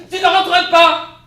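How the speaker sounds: high, very loud, slow outburst; outraged shout. Acted. Wife shouting back at husband, furious. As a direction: explode in disbelief.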